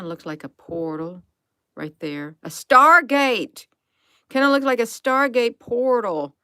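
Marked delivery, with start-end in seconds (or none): 2.4-6.4 loud, high-pitched burst of discovery; excited exclamation. Real, not acted. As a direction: call out the find, high and loud. Exclaims on spotting a portal.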